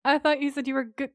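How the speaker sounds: high, loud, tripping over the words; shocked stuttering. Retold or imitated. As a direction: stammer it out in disbelief. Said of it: "so shocked he can't even get the words out. He's, like, stuttering".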